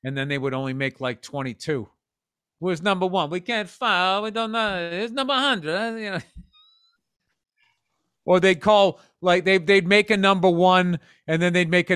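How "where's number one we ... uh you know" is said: mock Italian. Mock Italian record keepers.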